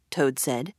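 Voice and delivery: ordinary delivery, nothing marked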